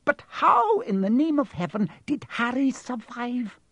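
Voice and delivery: high, disbelieving question; bewildered question. A narrator's reading. McGonagall asking Dumbledore how Harry survived, bewildered. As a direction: ask in astonishment, pitched high.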